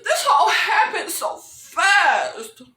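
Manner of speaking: drawn-out mock imitation of a character; mocking quote. Recapper mimics Miranda's excuse, slow and mocking. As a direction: stretch the last word out slowly.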